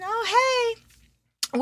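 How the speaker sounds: normal talking voice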